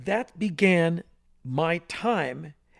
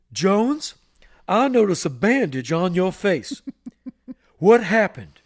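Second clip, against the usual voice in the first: lightly voiced stern general; 3 semitones higher, 6 dB louder than usual. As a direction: boom it out like the school disciplinarian. General Price questions Jones.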